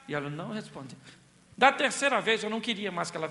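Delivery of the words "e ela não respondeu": normal talking voice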